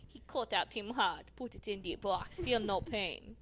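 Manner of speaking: softly imitated Caribbean accent; quiet put-on Caribbean accent; mock Caribbean accent. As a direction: retell it in a hushed Caribbean voice. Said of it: "they talk like that in the Caribbean".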